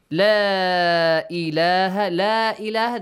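لا held only lightly before إله; لا إله with a short stretch; short optional mad. Shown as a demonstration. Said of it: "the mad is not wajib".

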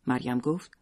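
ordinary delivery, nothing marked

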